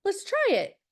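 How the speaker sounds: bright agreement. Retold or imitated. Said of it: "And I said".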